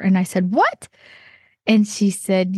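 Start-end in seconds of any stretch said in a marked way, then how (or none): 0.5-0.9 a shocked, much higher yelp of disbelief; surprised what. Retold or imitated. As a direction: jump way up in pitch, caught off guard. Girl says she is a vendor.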